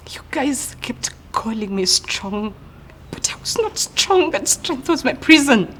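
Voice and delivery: hurt breaking out after being called strong; anguished protest. Acted.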